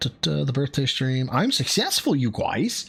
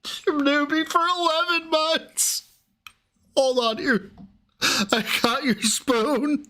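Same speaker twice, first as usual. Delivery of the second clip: squeaky laughing fit; 10 semitones higher, slower than usual. Real, not acted.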